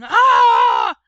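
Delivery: very loud, drawn-out "ah"; mock shriek of a frightened boy; imitated scream. A narrator's reading. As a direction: burst into a hammed-up scream. Narrator imitates brother's scream.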